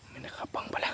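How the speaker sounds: very soft, whispered observation; hushed whisper. Real, not acted. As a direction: whisper it so nobody overhears. Spying quietly, keeping voices down.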